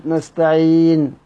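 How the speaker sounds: نستعين with the long ee held two counts, slowly; short madd. Shown as a demonstration. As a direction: hold the ee of نستعين briefly before the stop. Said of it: "كسر توسط مد". (نستعين بالقصر)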